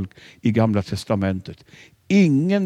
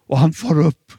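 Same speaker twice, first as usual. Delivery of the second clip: ordinary delivery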